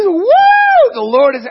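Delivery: high, loud joyful whoop; excited whoop. Retold or imitated. David whooping with joy before the Lord, excited. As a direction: cheer it out like a man dancing for joy.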